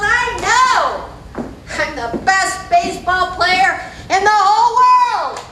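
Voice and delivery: loud, high, drawn-out boast; bragging little boy. Acted. Little boy on the baseball field, bragging loudly to himself. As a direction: crow it out like a cocky kid.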